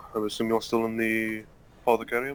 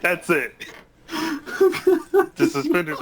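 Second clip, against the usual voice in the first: higher, louder, through laughter; laughing hard; 7 dB louder than usual. Real, not acted. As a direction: try to explain while cracking up. Player cracks up describing the costume.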